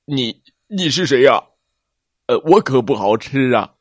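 nervous big dinosaur fearing he'll be eaten; scared T-rex. Acted. T-rex waking, afraid of the stranger, stammering. T-rex wakes being licked.

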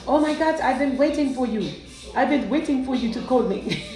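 put-on, all-purpose African accent; playful, inexact African accent; mock African accent. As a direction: imitate an eager suitor in a rough African accent.

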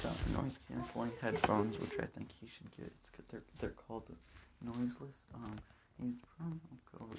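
hushed, soft ad read; whispering. Acted. Host reads the ad in hiding.

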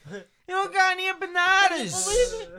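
very high, slow mock holler; yelling upstairs. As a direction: stretch it so it carries up the stairs. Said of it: "just, like, yelling upstairs".

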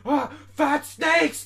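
slow, high, loud scream of alarm; panicked yell. Retold or imitated. Retelling a dream, yelling in terror at fat snakes. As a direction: shriek it like you're being chased. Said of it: "freaking out for no reason".

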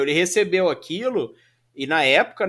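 usual speaking voice

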